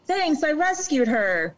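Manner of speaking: high, slow, drawn-out humblebrag; mock self-satisfaction. Owner showing off a rescue to an admirer, mock smugness.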